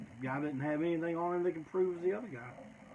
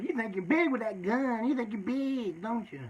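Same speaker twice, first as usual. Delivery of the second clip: a lightly voiced sneering taunt, pitched up; taunting mock voice; 6 semitones higher than usual. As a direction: needle the gunman, a hint of smug jeering. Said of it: "Chicken Hawk's sitting there going".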